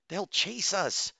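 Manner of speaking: high, drawn-out alarmed protest; slow, high, tense warning; urgent hiss. A narrator's reading. Doctor warns rescuers of pursuit, high urgent hiss. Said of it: "Bose hissed".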